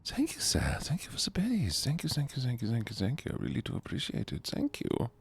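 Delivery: high whispery little creature voice; tiny creature thanking a viewer for berries, high and whispered; different voice. Acted. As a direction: squeak out thanks like a tiny critter. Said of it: "I could use a different voice".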